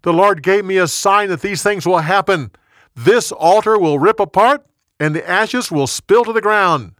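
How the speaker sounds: loud, high proclamation to the crowd; prophet's yell. A narrator's reading. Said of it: "The young prophet yelled".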